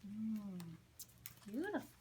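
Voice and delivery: ordinary delivery, nothing marked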